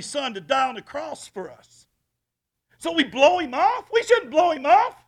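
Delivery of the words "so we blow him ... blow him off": loud, high sermon cry; preaching at full force. Acted. Pastor rebuking those who blow God off, loud preaching. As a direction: rebuke the church with passion.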